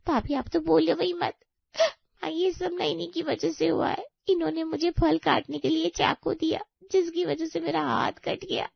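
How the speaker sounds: high, crying complaint; tearful accusing. Acted. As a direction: blame her through your tears. Injured, tearfully blames sister-in-law. (रोते हुए इल्ज़ाम लगाना)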